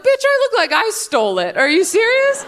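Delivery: can't believe the question; mock outraged reply to a friend; incredulous. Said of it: "I'm like".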